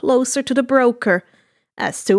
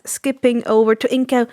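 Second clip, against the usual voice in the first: ordinary delivery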